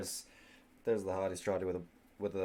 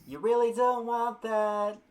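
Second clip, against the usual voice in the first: loud, slow, high-pitched warning; high mock warning; 13 semitones higher, 9 dB louder, slower than usual. Warning the smitten girl off the vampire, high mock warning.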